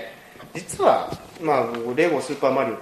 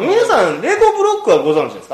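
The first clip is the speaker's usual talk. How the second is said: excited host question; 9 semitones higher, 6 dB louder than usual. Acted. Host addresses listeners theatrically.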